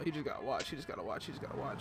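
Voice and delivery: hushed murmur under the breath; repeated hushed aside; whisper. Real, not acted. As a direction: say it low so he doesn't hear.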